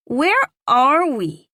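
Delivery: put-on high, slow child's question; wondering little boy. A narrator's reading. Small boy asking his mother in the lobby, high, slow and wondering. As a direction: ask slowly like an awed small boy.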